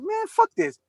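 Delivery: mock exasperated, pitched high; retold fed-up dismissal. Viewer reacting to the accent on TV, exasperated. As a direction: toss it off in high-pitched disgust. Recalls his reaction to the show.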